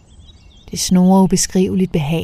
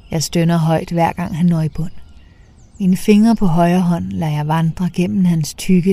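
ordinary delivery, nothing marked

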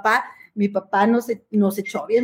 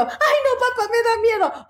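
imitando a una niña asustada, mucho más aguda; miedo fingido; 11 semitones higher than usual. Retold or imitated. Hija asustada ante el perro. (la hija le dice al papá que le da miedo, aguda)

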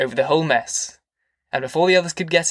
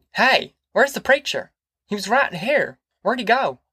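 much higher, sudden question; surprised call; 8 semitones higher than usual. A narrator's reading. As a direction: suddenly look round for him. Tom asks suddenly.

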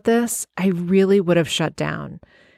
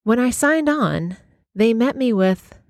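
normal talking voice

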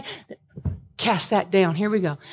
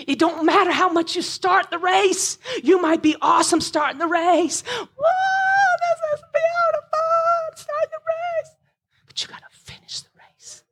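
full-force preaching; 12 semitones higher than usual. Acted. Preacher cries out to the congregation, in a full-force wail. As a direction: belt it out like a revival preacher.

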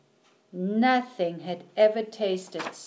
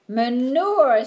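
Manure, said louder with a proud falling tune; triumphant; 4 semitones higher, 7 dB louder than usual. A narrator's reading. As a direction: announce Manure proudly, firm fall. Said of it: "said Winnie triumphantly".

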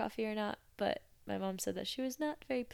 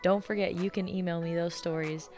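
louder, upbeat sign-off over music; podcast outro read; 6 dB louder than usual. Acted. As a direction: wrap up the show like a host thanking listeners.

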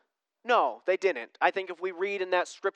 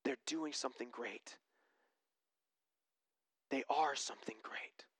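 awed whisper; 6 semitones lower, 14 dB quieter than usual. Retold or imitated. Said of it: "We all want people to look at us and go".

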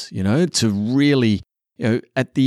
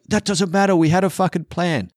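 much higher, rigid insistence; mock indignant snap; 9 semitones higher than usual. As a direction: dismiss her like the plan is sacred. His past self snapping back.

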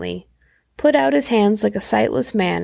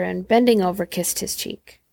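ordinary delivery, nothing marked

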